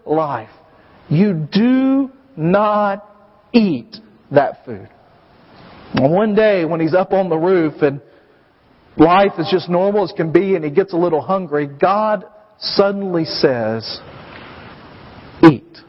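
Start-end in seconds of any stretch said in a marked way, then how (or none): 1.1-4.9 very slow, each word set apart; drilled rule. Retold or imitated. 15.4-15.8 loud low bark of eat; sudden command. Retold or imitated.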